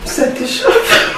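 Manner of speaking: laughing. Real, not acted. Teasing the burned friend.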